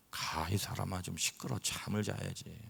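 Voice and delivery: soft, half-asleep complaint; sleepy grumble. Retold or imitated. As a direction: mumble it from bed. (잠결에 작게 투덜대기)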